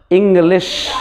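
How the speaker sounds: इंग्लिश said slowly with a clear final sh; listen to the sh at the end of इंग्लिश; इंग्लिश as a careful demo; model pronunciation. Shown as a demonstration. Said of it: "sound देखो".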